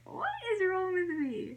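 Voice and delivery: very high mock-crying squeal; imitating a helpless, high-pitched whine; retold laughing wail. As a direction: wail it in hammed-up embarrassment, way up high. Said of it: "I'm laughing so hard".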